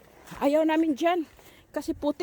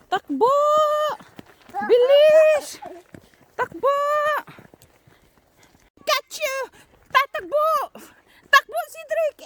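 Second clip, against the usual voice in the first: excited yelling; 13 semitones higher, 6 dB louder, slower than usual. Pretend. Chase game, calling out running.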